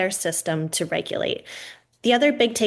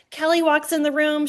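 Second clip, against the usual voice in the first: ordinary talk, nothing marked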